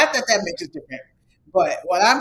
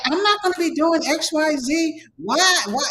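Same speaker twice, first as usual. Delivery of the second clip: mocking complaint; 9 semitones higher than usual. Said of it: "voicing the job seeker".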